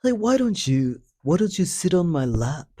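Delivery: high, wheedling mock old man; lightly mocked creepy old guy. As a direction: coax her like a sleazy old man. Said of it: "the guy says to her".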